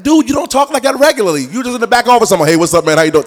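high, loud, fed-up protest; worked-up, incredulous scolding; exasperated. Acted. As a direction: call out a phony preacher, loudly. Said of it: "that gets under my skin because I'm like".

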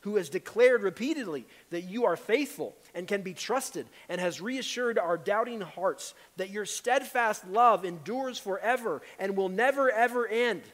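loud, high, earnest prayer; fervent prayer. Acted. Pastor praying over the congregation, fervent and loud. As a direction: lift it up to God with force.

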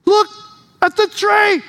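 Peter amazed, calling out; surprised exclaim. Retold or imitated. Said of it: "and Peter goes".

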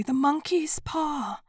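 high and slow, still crying out; wife crying out for the paw, high and slow; wild cry. A narrator's reading. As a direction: stretch the words in a wild plea. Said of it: "she cried wildly".

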